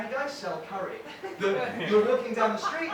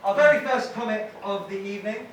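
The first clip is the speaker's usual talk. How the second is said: louder, showman's announcement; compère introduction; 3 semitones higher, 5 dB louder than usual. Real, not acted. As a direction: bring her on like an MC. MC introduces next comic.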